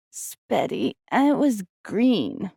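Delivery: put-on slow, high, grossed-out child; a small boy who hates his dinner; disgusted little boy. A narrator's reading. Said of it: "as if he was disgusted by it".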